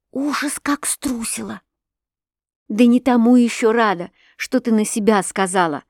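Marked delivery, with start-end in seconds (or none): 0.1-1.6 hushed whisper of fright. A narrator's reading. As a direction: confess in a breath. Whispered confession of fear.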